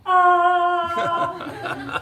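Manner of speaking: high yelp of recognition, half laughing; mock delighted shriek; high startled laugh-cry. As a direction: squeal at the dentist who doesn't know you. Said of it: "So I went".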